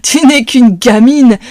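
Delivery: put-on sneering thug laughing at a girl; mocking laugh. A narrator's reading. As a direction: laugh her off as a kid. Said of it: "éclate de rire".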